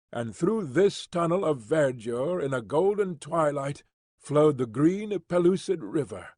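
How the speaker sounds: ordinary delivery, nothing marked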